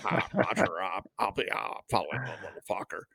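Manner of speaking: laid-back pilot reading back clearance; airline pilot voice. Retold or imitated. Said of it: "airline pilot voice".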